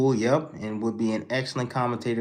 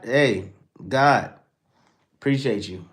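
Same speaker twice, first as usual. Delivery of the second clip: ordinary delivery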